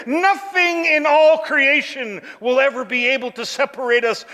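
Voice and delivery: high, ringing list with every "not" hit; full-force preaching. Acted. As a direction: build a relentless proclamation, then come down towards the close. Preaches climax of the verse.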